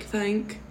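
"thank" begun with th, not t; soft th. Shown as a demonstration. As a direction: demonstrate "thank" with a clear th. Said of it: "not tank".

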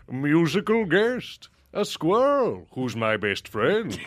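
slow, booming announcer; Don Pardo impression. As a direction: announce it like an old TV host. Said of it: "it's Don Pardo introducing the musical guest".

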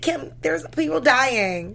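high, drawn-out mock reproach; imitated upset scolding. Quoting a famous sister row, imitated upset scolding. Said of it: "when she was upset".

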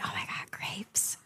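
soft unvoiced whisper. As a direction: breathe it out in an ASMR whisper. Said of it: "learned how to whisper".

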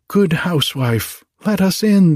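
high-pitched storybook plea to be let in; crying out. A narrator's reading. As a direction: call out high, as if through a door. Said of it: "they cried".

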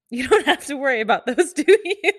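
retold comeback cracked up by laughter; laughing retort. Nurse teasing male coworker in retelling, laughing.

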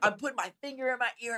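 ordinary delivery

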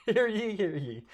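loud herald's proclamation, pitched high; mock royal decree. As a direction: announce it like a town crier. Unrolling paper scroll like herald.

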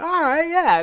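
high, loud burst of confidence; excited retold confidence. Retold growing confidence while practicing.

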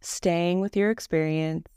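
calm, even meditation voice with long pauses; guided meditation. Acted. Leads a Yoga Nidra meditation.

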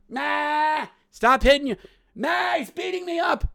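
put-on high kid wailing to Mom; shrieking tattletale complaint, acted out; whining younger brother.

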